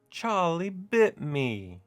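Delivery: slow, high mock British accent with the stress on bit; the meme line again in a bad British accent, drawn out; terrible British accent. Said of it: "my terrible British accent or English accent".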